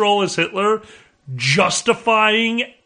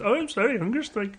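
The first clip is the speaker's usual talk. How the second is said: higher, softer put-on Indian accent; mock Gandhi accent; 4 semitones higher, 7 dB quieter, faster than usual. Co-host redoing Gandhi's apology on request, soft Indian accent. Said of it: "where's your accent?".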